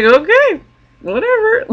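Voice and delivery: high, airy okay whatever in a put-on voice; sing-song dismissal of the hugging guy; mock dismissive. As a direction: brush it off with a breezy shrug. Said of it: "I was like".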